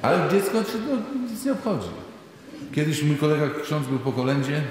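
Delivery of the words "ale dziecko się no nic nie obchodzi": high, amused delivery. Acted. Preacher tells of the indifferent child, high and amused. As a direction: pitch it up with playful glee.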